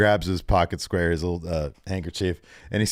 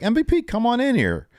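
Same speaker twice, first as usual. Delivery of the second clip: mock call. Brock calls MVP into ring.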